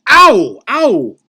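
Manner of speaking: two sharp high yelps of pain. Acted. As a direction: cry out as if just slapped. Slapped, crying out in pain.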